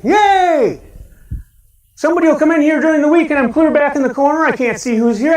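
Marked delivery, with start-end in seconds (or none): 0.0-0.8 high, bright cheer of welcome; famous yay. Shown as a demonstration. Pastor showing the congregation his famous greeting yell. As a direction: cheer it out like you're thrilled to see them. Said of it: "that's my famous thing".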